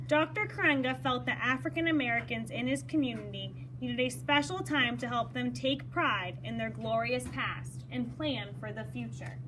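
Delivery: plain reading voice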